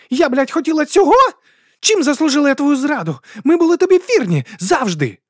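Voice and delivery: very high, loud, outraged demand; near-screaming fury. A narrator's reading. Woman demands why the duke betrayed her, nearly screaming. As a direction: shriek the accusation at the betrayer. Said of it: "Вона ледь не верещала з люті".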